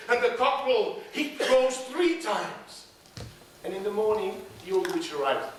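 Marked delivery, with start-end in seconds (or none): none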